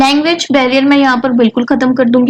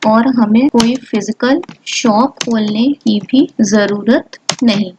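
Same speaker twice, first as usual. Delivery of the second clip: slow, word by word dictation; slow typing-along dictation; 2 semitones lower, slower than usual. Acted. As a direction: speak each word slowly as you type it.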